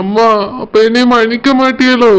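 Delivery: near tears, asking to be forgiven; a choked, crying question; tearful plea. Acted. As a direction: plead through the tears. Son begs mother's forgiveness.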